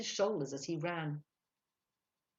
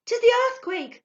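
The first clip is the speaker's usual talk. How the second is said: shrill, high woman's shriek; very loud, shrill panic; terrified scream; 16 dB louder than usual. A narrator's reading. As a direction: scream it in ghastly terror. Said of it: "screamed a woman's shrill accents in ghastly terror".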